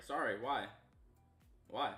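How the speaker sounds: ordinary talk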